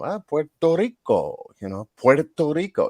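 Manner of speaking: put-on Puerto Rico in a light Puerto Rican accent, with a rolled r. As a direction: say Puerto Rico the island way, like a local. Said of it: "I was saying words with the accent".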